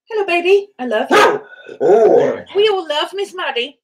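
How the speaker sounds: high-pitched cooing to a pet. Real, not acted. Woman fussing over her beloved dog, gushing baby talk. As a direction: coo it adoringly, drawing out the love.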